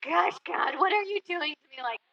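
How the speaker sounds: mock complaining to God, half-laughing; exasperated prayer. Teacher retelling her frustrated talks with God, mildly exasperated. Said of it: "there were many times it's like".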